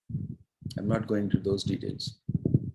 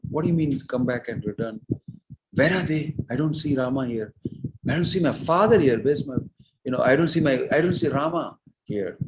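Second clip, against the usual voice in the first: higher, louder acted bewildered questioning; agitated questioning; 5 semitones higher, 5 dB louder than usual. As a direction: act out an insistent, searching son. Bharata urgently questions his mother.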